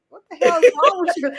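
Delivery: mock annoyed husband snapping back; imitating husband. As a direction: play the fed-up husband. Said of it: "my husband probably be like".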